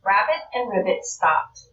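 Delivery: usual speaking voice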